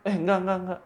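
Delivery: high, flustered quick denial; mildly acted startled backpedalling. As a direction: act caught off guard, voice jumping up. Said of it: "diliatin balik kan kaget dia".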